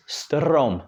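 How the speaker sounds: strom with a long trilled R; dragged-out R. Shown as a demonstration. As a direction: pull the R in strom. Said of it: "dragging out, I'm pulling that R".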